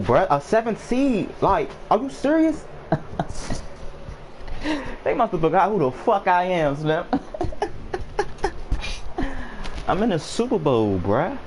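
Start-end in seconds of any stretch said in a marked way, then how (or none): 2.7-5.0 soft breathy laugh, half stunned; quiet giggle at your own luck; airy laugh of disbelief. Real, not acted. Stunned at reaching Super Bowl. 7.1-9.8 high giddy laugh of disbelief; real incredulous laughter. Real, not acted. Stunned at reaching Super Bowl.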